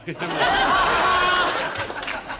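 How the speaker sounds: loud high-pitched cackle; real laugh at own joke. Real, not acted. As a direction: let the laugh burst out.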